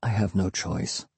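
very soft, subdued father; just above whisper. A narrator's reading. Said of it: "his voice just above a whisper".

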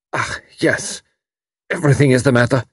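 put-on strained, unnatural young man; lightly voiced German refugee in distress; hoarse and unnatural. A narrator's reading. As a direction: force the words out of a tight throat. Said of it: "His voice was hoarse and unnatural".

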